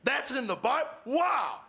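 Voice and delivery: high-pitched, astonished wow; hammed-up amazement, pitch leaping up; amazed. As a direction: blown away by a discovery. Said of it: "And I went".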